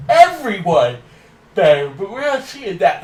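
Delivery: real yawn pushing up the voice; words stretched by a rising yawn; talking through yawn. Real, not acted. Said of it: "I'm sorry, I keep yawning".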